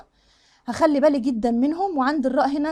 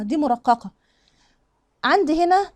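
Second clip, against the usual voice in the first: ordinary delivery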